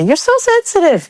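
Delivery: high mock whine of a dismissive friend; mocking dismissal. Mimicking friends brushing off her hurt, mock whine. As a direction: throw it off, belittling. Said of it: "quoted dismissal".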